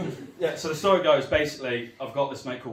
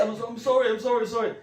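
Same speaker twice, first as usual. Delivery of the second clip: high, hurried repeated sorries; a touch of mock panic; panicky apology; 9 semitones higher, faster than usual. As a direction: grovel fast to the robber. Victim apologising to robber.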